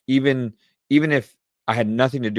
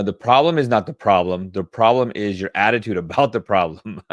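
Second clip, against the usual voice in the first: louder, emphatic recital with a laugh on attitude; punchy read of the right quote; proud quote delivery; 6 dB louder than usual. Shown as a demonstration. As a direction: announce the found quote with triumph. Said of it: "I wanna get this quote right".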